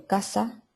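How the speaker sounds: casa with the final s dropped. Shown as a demonstration. Teacher demos Andalusian lost final s. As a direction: say casas as casa. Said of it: "en vez de decir casas".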